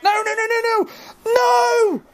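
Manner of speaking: high string of yelps rising to a shout; panicked shouting. Real, not acted. Player under sudden attack, panicked shouting. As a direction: cry out high in alarm.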